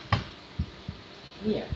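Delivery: whispered call to the cat. Real, not acted. Owner softly whispering to coax the cat over. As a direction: breathe the words with no voice.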